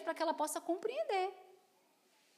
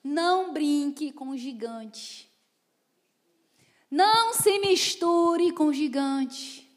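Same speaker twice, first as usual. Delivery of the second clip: slow, loud preaching cadence; forceful preaching; 2 semitones lower, 7 dB louder, much slower than usual. Acted. Preacher warning the congregation, slow and loud. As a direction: hammer each warning slowly.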